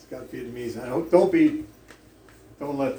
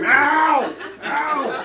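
Mao shouted high and loud, with a laugh breaking in; yelling the cat's name; 14 semitones higher, 8 dB louder than usual. Retold or imitated. Foreigner in a tie calling the cat under cars, shouting. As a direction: call Mao down the street at full voice. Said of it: "down the street".

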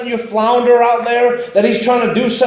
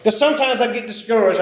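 normal talking voice

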